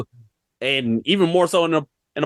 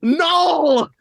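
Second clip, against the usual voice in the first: heated excitement; 10 semitones higher than usual. Real, not acted. Heated fan argument about Venom.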